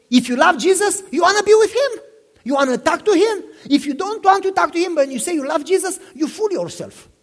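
high, loud preaching burst. Acted. Pastor pressing the congregation on loving Jesus, rising preaching voice. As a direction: drive the point home to the congregation.

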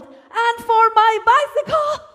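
put-on high, loud little-girl shout; child's yell. A little girl demands her share of the money, loud shout. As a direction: yell it with all your lungs like a four-year-old. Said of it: "with all my lungs, I yelled".

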